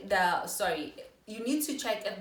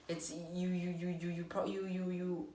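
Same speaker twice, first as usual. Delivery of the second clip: stuck repeating the word you; stumbling stutter on you; 2 semitones lower, 7 dB quieter than usual. Real, not acted. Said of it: "sorry for stuttering".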